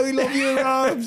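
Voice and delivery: loud, very high mock cheer; imitated drinkers' yell; rowdy cheer. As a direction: whoop it like mates egging on their host. Acting drinkers cheering host at night.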